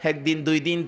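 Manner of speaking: usual speaking voice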